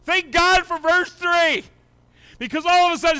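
high, loud joyful preaching; excited preaching. Acted. Pastor praising God for verse three, excited.

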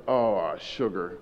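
low, soft, slow regret; deflated dismay. Retold or imitated. Husband realizes he wrongly finished wife's sentence, deflated. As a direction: let it sink as you realize the blunder.

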